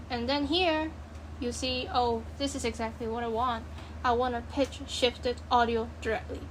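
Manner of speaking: usual speaking voice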